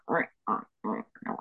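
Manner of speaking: low, rasping mock rubbery squeak; wetsuit squeak. Imitating a wetsuit for a friend, low rasping squeaks. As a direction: imitate a creaking wetsuit under your breath. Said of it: "it goes like".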